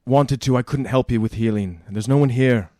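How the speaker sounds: loud, insistent in-character brush-off; firm refusal. Acted. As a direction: turn the stranger away loudly. Player refuses a pleading stranger.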